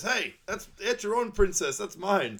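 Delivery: playful high Shrek impression; high loud mock Shrek. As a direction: squawk it like an indignant ogre. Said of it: "Shrek goes".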